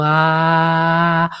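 heart-centred ah. Shown as a demonstration. Voice coach showing Laura's true heart A sound. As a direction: let a low, grounded "Ah" settle in the heart. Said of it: "una A realmente de corazón".

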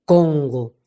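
CONGO low, at the back of the palate; back resonance. Shown as a demonstration. Voice coach places a word at the back, low. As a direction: sink Congo behind, along the palate. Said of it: "ahí detrás por el paladar".

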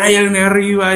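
high-pitched repeated call for help; storyteller's climber calling out high; acted shout. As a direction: cry out upward, hoping someone hears. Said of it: "vuelve a gritar".

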